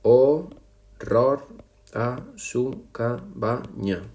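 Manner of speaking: one syllable at a time, evenly spaced; split into syllables. Shown as a demonstration. Teacher to students, counting syllables, syllable by syllable. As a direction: tap out each syllable for the class. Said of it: "pues lo mismo".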